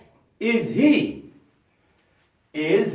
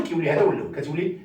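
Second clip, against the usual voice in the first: ordinary talk, nothing marked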